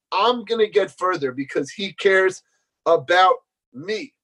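ordinary talk, nothing marked

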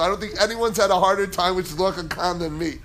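ordinary talk, nothing marked

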